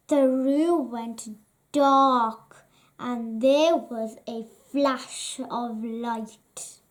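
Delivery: ordinary delivery, nothing marked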